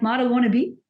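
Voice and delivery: laughing through a joking question; amused, self-mocking reply.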